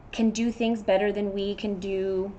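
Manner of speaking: ordinary delivery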